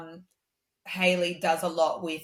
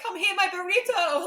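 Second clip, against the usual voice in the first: much higher, cooing mock endearment; playful coddling; 13 semitones higher than usual. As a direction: coo it like wrapping a baby. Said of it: "I'm like".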